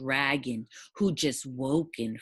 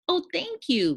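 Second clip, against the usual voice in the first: much higher, gushing troll thanks; grateful cry; 14 semitones higher, 3 dB louder than usual. Acted. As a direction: burst out thankful up high. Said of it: "cried the grateful troll".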